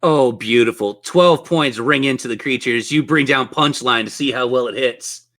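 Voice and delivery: normal talking voice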